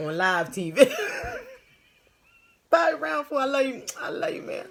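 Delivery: loud, high, cracking up; giggling fit on live stream; laughing. Real, not acted. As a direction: laugh through the words. Laughing at friend's message.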